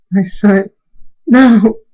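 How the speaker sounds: high, anguished cry; distressed, rising to a loud "no"; tearful mother. Acted. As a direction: wail in grief for your son. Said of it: "Tears fall from Luna's eyes".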